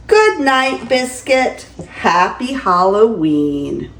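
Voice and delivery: lively, sing-song picture-book reading; grandma reading a bedtime story; reading to children. Acted. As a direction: read it to a small grandchild with delight. Grandma reads picture book aloud.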